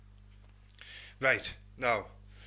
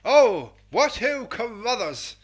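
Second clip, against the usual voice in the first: loud, high hammed-up toff greeting; daft posh voice; 7 semitones higher, 10 dB louder, slower than usual. Host greets the letter writer in a daft posh voice. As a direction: boom it out like a plummy old colonel. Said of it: "Don't know why I'm doing this daft voice".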